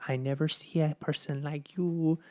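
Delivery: a hint of a Mexican accent, lightly imitated. Imitating a server in Panda Express, light Mexican accent. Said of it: "in the little accent".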